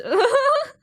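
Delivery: loud squealing laugh; shrill burst of giggles; high burst of laughter. Real, not acted. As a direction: crack up. Cracking up at the memory.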